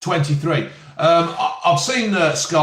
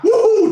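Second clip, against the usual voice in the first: high, loud woo; excited whoop; 5 dB louder than usual. Real, not acted. Man celebrating getting the hand of it, excited whoop.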